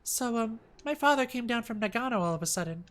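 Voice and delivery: put-on high, quick young woman voice; girlfriend voice. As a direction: sound apologetic and girlish. Rumi voiced on phone.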